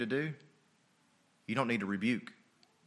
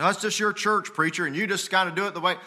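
raised, louder mimicking voice; mock a parishioner with higher pitch and quicker pace; imitated church member; 8 semitones higher, 5 dB louder than usual. Church member telling the preacher it's his church, mock imitation.

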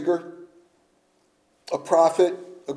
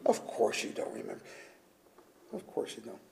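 hushed aside; 6 semitones lower, 14 dB quieter than usual. Real, not acted.

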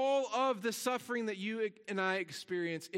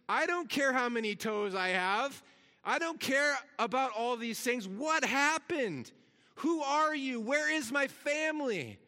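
higher, louder frantic cry; confused outburst, pitched up and pushed; retold scream; 5 semitones higher, 4 dB louder than usual. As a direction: accident victim crying out in confusion, higher and louder. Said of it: "I screamed".

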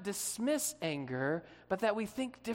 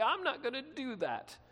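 petulant, higher-pitched mock sulk; pouting refusal; 5 semitones higher than usual. Rich young ruler stomping off from Jesus, pouting. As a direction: play a pouting tantrum. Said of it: "he stomps off and he pouts".

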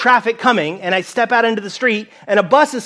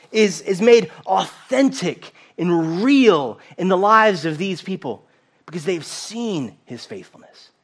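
ordinary talk, nothing marked

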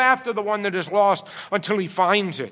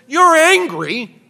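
much higher, much louder, slow and drawn out; God's stern rebuke; 10 semitones higher, 12 dB louder, much slower than usual. Acted. God rebukes the angry prophet, loud and slow. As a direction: call him out hard, word by word. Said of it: "He says".

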